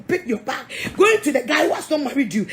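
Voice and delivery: ordinary talk, nothing marked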